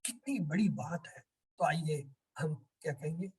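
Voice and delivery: ordinary delivery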